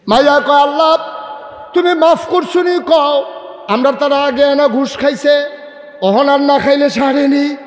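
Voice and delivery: full-force preaching. Real, not acted. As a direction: ring it out to the crowd, pitched high.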